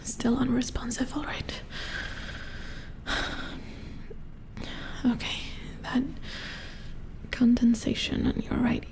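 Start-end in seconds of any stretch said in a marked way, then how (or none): 1.7-5.0 long, hushed exhale; drawn-out worried technician's sigh. Pretend. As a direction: let out a slow, whispery sigh. Technician finds patient still unresponsive.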